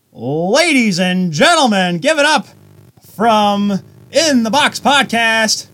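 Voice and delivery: slow, high booming ring announcer; grand entrance announcement. Acted. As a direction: stretch the name like a ring announcer. Introducing the guest.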